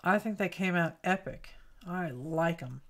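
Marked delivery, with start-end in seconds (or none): none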